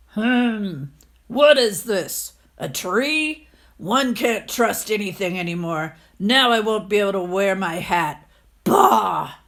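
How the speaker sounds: put-on loud, cranky old miser voice; puzzled grumpy old man. The landlord discovering a tree on his head, loud and indignant.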